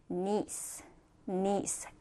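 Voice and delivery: "niece" said low and soft for repeating; low, soft teaching "niece", each one set apart; model pronunciation. Shown as a demonstration. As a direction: model "niece" for learners, softly. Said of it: "자, 발음해볼까?".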